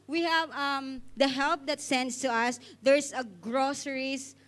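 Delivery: ordinary talk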